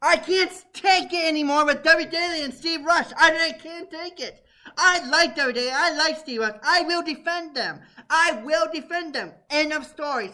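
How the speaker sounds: can't take it anymore, yell it out; blowing up. Real, not acted. Guest loses his temper at the show's hosts, angry shouting. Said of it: "I'm sorry that I blew up again".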